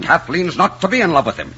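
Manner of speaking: high, indignant veto; heated and insistent; adamant refusal. Acted. Angry refusal in quarrel.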